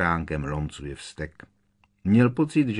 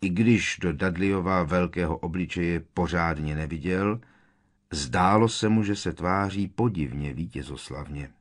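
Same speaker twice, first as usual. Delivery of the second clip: ordinary delivery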